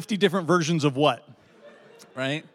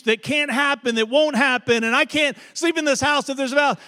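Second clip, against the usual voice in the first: higher, mock-upset call from the garage; retold shouting; 9 semitones higher, 4 dB louder than usual. Wife shouting up from the garage, mock upset and higher. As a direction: play the frantic wife shouting up. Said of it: "she's shouting back up to me".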